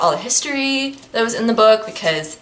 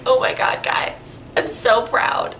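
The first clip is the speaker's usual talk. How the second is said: whispered pride; 2 semitones higher, 3 dB quieter, slower than usual. Real, not acted. Gushing proud of the characters.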